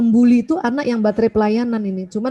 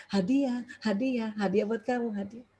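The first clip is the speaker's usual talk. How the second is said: soft sing-song; 9 dB quieter than usual. Retold or imitated. Voicing gift words softly.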